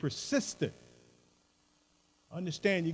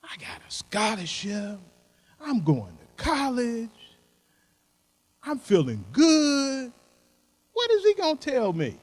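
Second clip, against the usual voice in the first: put-on higher voice, a young know-it-all doubting the old man; imitating a cocky student; 5 semitones higher than usual. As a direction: play the student who thinks he knows it all, pitched up. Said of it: "I know right now you say".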